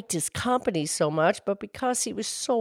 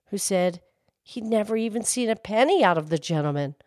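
usual speaking voice

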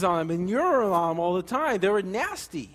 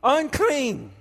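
raised, higher cry of unclean; leper calling out; 7 semitones higher, 4 dB louder, much slower than usual. Retold or imitated. A leper crying out his warning to the village, raised and high. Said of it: "he would have to scream out the words".

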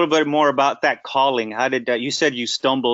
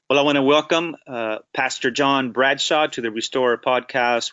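ordinary talk

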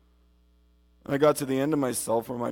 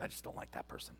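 muttered aside; 19 dB quieter, faster than usual. Retold or imitated. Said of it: "and you're just like".

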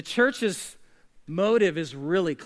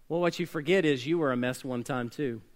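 ordinary talk

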